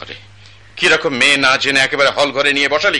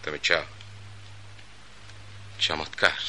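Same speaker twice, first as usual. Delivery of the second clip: usual speaking voice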